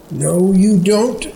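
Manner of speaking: blurted. A narrator's reading. Anna refusing to let Chris slip away, blurting it out. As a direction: fling it out across the ice. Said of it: "she blurted".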